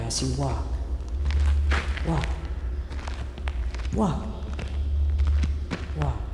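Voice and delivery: hushed guiding voice in the tunnel. Acted. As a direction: draw the listener into the dark quietly. Entering the dark tunnel.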